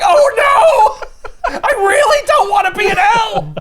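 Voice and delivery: high, loud mock wailing through laughter; hammed-up panic. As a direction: whimper and wail like a terrified hero. Batman freaking out in hell.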